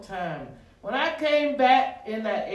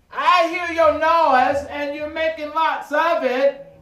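loud, higher, drawn-out challenge; defiant declaration; 6 semitones higher, 10 dB louder, slower than usual. Acted. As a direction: stand up to the bully, loud and slow. Defiant words to the devil.

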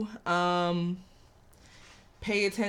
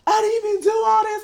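high, loud, fast mocking whine; mock R. Kelly whine; 13 semitones higher, 8 dB louder, faster than usual. Vlogger imitating R. Kelly's denial, high whiny mockery. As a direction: whine it like a child denying it. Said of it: "talking about some".

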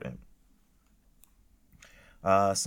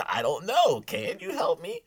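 much higher and louder, incredulous mock; mock customer firing back at a rude cashier; exasperated customer; 12 semitones higher, 6 dB louder than usual. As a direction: throw the question back in disbelief. Mocking a rude Wendy's worker.

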